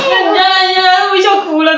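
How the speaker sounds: high, laughing so hard it cries; laughing to tears. Real, not acted. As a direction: laugh until you cry.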